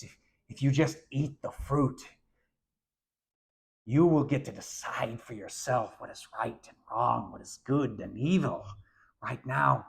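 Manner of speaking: whispered, nasal, snake-like shadow voice; Voldemort. Acted. Youth teacher voicing the serpent to students, whispered snake-like Voldemort. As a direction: hiss it like a dark lord tempting you. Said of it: "in the voice of, like, Voldemort".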